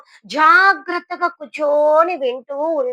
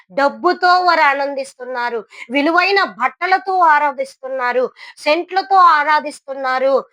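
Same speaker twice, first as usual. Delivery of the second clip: high, loud, rising rebuke from the pulpit; fiery preaching; 3 semitones higher, 6 dB louder than usual. Acted. Preacher rebuking the congregation, high, loud and fiery. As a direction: hammer home the churches' failure. Preacher lists rich worship.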